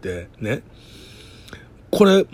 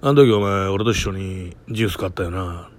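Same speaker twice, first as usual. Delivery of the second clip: movie actor tone; 6 semitones lower than usual. Retold or imitated. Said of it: "映画の俳優みたい".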